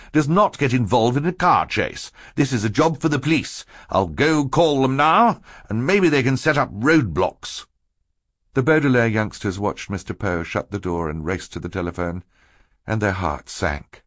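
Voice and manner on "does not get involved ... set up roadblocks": higher stern lecture; firm, scolding tone. A narrator's reading. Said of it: "Mister Poe said sternly".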